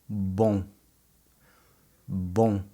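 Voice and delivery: bom ending nasal, lips open; nasal vowel. Shown as a demonstration. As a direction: say bom without closing the lips. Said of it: "my lips don't touch at the end".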